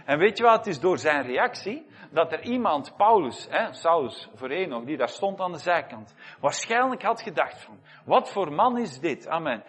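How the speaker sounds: fiery pulpit preaching building up; preaching at full force. Acted. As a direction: drive the message home to the congregation.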